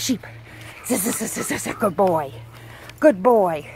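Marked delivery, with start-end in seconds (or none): none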